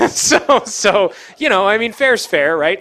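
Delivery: high, loud burst through laughter; amused, laughing. Real, not acted. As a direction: laugh it out, joking with the room. Laughs at own threat to get angry.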